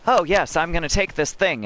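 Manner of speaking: mock Miris. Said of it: "who is like".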